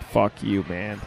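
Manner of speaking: low, soft, deadpan mutter; muttered under breath. Retold or imitated. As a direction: mouth it quietly as a silent look. Roommate's silent look voiced.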